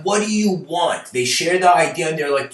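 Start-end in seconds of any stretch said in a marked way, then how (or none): 0.0-1.1 higher, louder direct question; blunt salesman. Retold or imitated. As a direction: cut straight to the point. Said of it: "some salesmen who are like".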